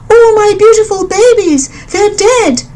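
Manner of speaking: high, pleading bird voice; crying mother bird. A narrator's reading. Grieving mother bird to the elephant, high and pleading. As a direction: plead over your dead babies. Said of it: "cried the mother bird".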